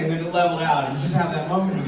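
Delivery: ordinary delivery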